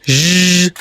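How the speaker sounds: long buzzing "zh"; zh sound. Shown as a demonstration. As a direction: hold "zh" as in measure. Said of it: "Do you know this sound?".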